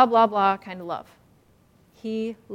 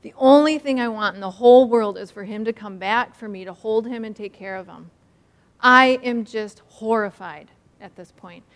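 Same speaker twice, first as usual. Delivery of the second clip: normal talking voice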